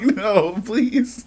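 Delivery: high-pitched comic begging; mock pleading. Hunted player begs the dogs off, high mock plea. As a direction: plead in a high, half-laughing voice.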